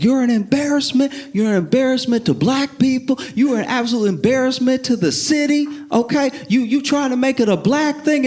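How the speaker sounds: high-pitched, insistent mock harangue; imitated protester yelling. Counter protesters heckling the mayor at his turn, mock yelling. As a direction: heckle in a high, hammed-up yell. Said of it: "counter protesters are yelling".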